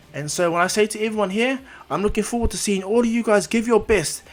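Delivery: rally the whole stadium, big and booming; rousing speech. Acted.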